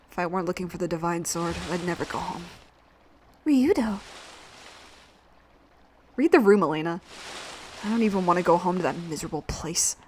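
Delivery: low, quiet, grudging hero voice; sullen reluctant hero. Acted. Voices reluctant Ryudo in game dialogue.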